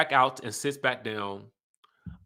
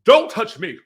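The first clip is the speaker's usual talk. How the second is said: loud, high mock snap; imitating an angry castmate; 9 semitones higher, 9 dB louder than usual. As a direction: snap it like a castmate who's had enough. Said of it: "Robin is like".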